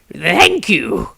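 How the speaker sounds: put-on high, slow old man musing; old wizard voice. Old wizard eyeing his younger self, high and slow. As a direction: lift the voice up high like an ancient wizard looking back. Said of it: "said the elderly newcomer".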